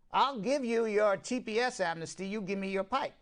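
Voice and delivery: loud, high Southern senator bargaining; a self-confessed bad Southern accent, pushed hard; hammed-up Southern politician accent. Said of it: "I'm not good at the accents".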